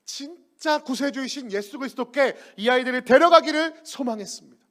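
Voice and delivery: ordinary delivery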